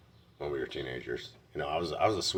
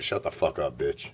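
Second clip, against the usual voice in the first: retold insult; 4 dB louder than usual. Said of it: "I was fucking calling her a fuck bitch".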